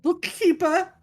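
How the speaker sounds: high, proud-sounding title; child in play. Acted. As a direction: announce your pretend job in a kid's pitch. Child gives pretend job title.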